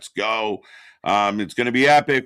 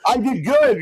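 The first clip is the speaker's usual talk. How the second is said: squeaky proud little voice; high mock proud voice; 12 semitones higher, 5 dB louder than usual. Imitating a proud friend.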